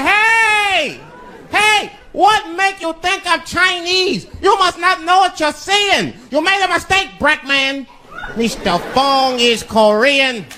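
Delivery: shopkeeper voice. Retold or imitated. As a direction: squeak out the grumpy store owner's warning. Voicing the shopkeeper.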